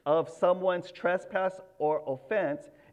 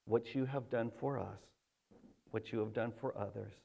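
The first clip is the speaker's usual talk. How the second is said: soft, low earnest prayer voice; hushed pastor's prayer to God; 9 semitones lower, 10 dB quieter than usual. Real, not acted. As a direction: pray quietly before the sermon.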